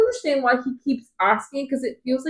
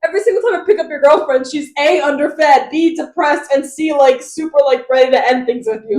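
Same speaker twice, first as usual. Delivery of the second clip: loud, raised, listing grievances; mock exasperated rant; 4 semitones higher, 9 dB louder than usual. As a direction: scold point by point in acted outrage. Voicing Rhys telling Tamlin off.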